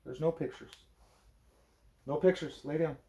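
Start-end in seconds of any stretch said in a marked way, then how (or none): none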